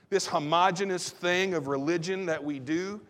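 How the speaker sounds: ordinary talk, nothing marked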